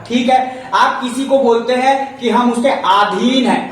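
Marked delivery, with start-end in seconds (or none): 2.7-3.5 अधीन with a long aa at the start; common mispronunciation. Shown as a demonstration. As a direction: say अधीन as "aa-dheen". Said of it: "अधीन नहीं होता".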